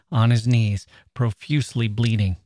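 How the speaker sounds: ordinary delivery, nothing marked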